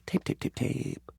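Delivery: whispering to himself while searching; whispered muttering. Acted. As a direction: mutter it under the breath.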